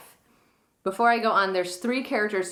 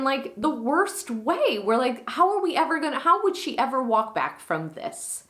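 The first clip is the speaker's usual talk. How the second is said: higher-pitched, emphatic paraphrased rant; acted indignation; 6 semitones higher than usual. Beatrice condemns Claudio for ruining Hero, mildly hammed-up indignation. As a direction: vent in exasperation on a cousin's behalf. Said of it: "And so Beatrice is saying".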